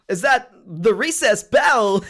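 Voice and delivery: high, loud, very slow halting impression; Christopher Walken. Kid at school asking about recess, Christopher Walken impression. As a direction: stretch the question out, high and halting. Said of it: "a Christopher Walken accent or something".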